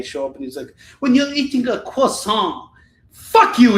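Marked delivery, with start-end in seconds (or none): 1.0-2.7 mocking French-style pronunciation, high and loud; hammed-up Frenchified etiquette lesson; William Hanson. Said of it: "pronounce everything like he's French". 3.1-3.8 loud, high outburst; hammed-up indignant shout; angry comic rant. Mock rant at etiquette coach.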